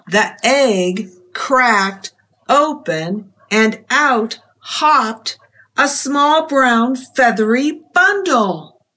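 ordinary delivery

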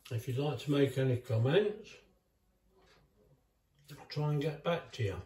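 talking with a mouthful of cake; words muffled by a bite of cake; mouth full. Real, not acted. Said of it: "trying to talk with my mouth full".

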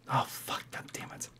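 unvoiced hissed curse under breath; frustrated whisper. Real, not acted. Gamer making a mistake, frustrated whisper. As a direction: curse quietly to yourself. Mishap in game.

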